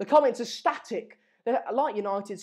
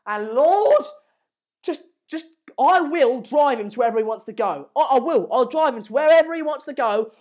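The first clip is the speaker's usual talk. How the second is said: loud, high overjoyed rant; excited; 4 semitones higher, 5 dB louder than usual. Real, not acted. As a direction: gush it out, overjoyed. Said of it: "Sorry, I got a bit excited there".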